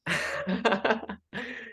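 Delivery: bright high-pitched laugh. Real, not acted. Reacts to sweaty comment.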